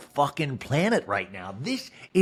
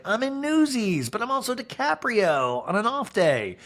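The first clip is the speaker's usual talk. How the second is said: mock newsboy voice; 8 semitones higher than usual. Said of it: "It's like".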